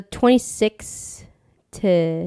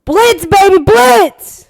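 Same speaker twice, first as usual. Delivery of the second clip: much higher, louder shouted chant; hype shout; 10 semitones higher, 9 dB louder, much slower than usual. Acted. Co-host chanting for his team, shouted hype. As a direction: yell it like a fan in the stands.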